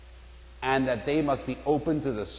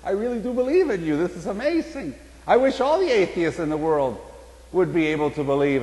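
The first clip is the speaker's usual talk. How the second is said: much higher, thrilled and gushing; higher-pitched, loud, astonished rapture; amazed excitement; 7 semitones higher, 4 dB louder than usual. Acted. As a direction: overcome with sudden faith, bursting with joy. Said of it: "he couldn't believe it".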